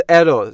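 "error" said the common wrong way, as students say it. Shown as a demonstration. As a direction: imitate the typical wrong "error". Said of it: "不是error".

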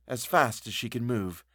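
usual speaking voice

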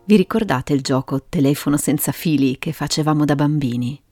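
usual speaking voice

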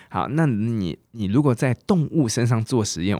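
usual speaking voice

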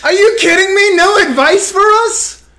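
high, loud cry of protest; outraged protest. Real, not acted. Host told the guest has no advice, loud high protest. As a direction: yell it like you've been cheated.

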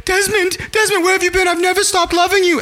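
very high, hammed-up woman's voice; Penny. Woman answering her long-lost love's call, hammed-up high-pitched voice. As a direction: gush it high, as a reunited lover. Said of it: "She's like".

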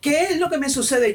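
high, stretched-out mock wail; retold tearful bewilderment. Newcomer bursting into tears at church asks herself, bewildered. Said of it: "ahí mismitico prorrumpí a llorar".

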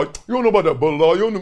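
fast, lightly voiced friend bragging; quick mock brag from the friend; imitating Daniel. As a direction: rattle it off like a proud older homie.

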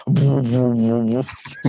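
sudden pulsing drone; imitated alien light noise. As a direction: burst into a throbbing alien hum. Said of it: "then they open up the closet".

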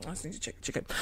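quick unvoiced whisper to herself; whispered aside. Real, not acted. To the viewers mid-story, a whispered aside. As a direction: breathe it out while checking. Aside while checking something.

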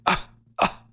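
breathy pretend cough; fake cough. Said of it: "that's a fake cough".

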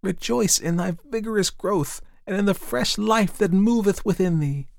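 very high, airy sunbeams speaking. A narrator's reading. Sunbeams bless the young fir in a high voice. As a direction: sing it down like light from above. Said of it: "said the sunbeams".

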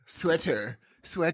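low, soft mimicry of an American accent; mock the American accent on "Sweater"; mimicked accent. Podcaster teasing friend over the word, mimicked American accent. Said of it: "why are you copying the American accent".

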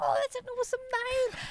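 very high, mock-delighted voice; gushing approval. Passer-by hearing the pig's name, gushing. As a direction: squeal it like a smitten onlooker.

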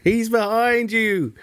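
high, slow pantomime shout-out; panto crowd callback. Acted. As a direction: call it out to the panto audience. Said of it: "It's turning into a pantomime".

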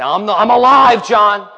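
loud burst on "alive"; emphatic proclamation. Acted. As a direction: declare it boldly to someone overawed. Voices Jesus declaring himself to John.

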